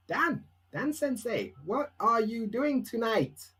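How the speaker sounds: high, slow, careful learner's question; imitated student. Students asking Dan Sensei about tonight, imitated high and slow. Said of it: "And they ask me, like".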